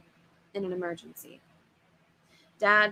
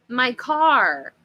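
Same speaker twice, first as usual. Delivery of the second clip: louder, higher panicked shout from Dad; frantic cry; 4 semitones higher, 7 dB louder than usual. Acted. Father panicking to the monster at the booth, frantic loud cry. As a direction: blurt it out in a panic. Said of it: "Dad cried frantically".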